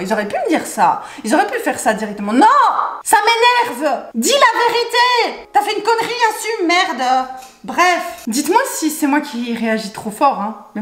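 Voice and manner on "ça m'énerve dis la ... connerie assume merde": high, slow furious yell. Real, not acted. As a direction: demand the truth, fed up. Furious outburst at staff. (colère aiguë et lente)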